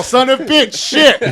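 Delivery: laughing through a slow, high mock foreign accent; class chorus in mock accent. Retelling how the whole class repeats it, laughing mock accent. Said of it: "And everyone's all".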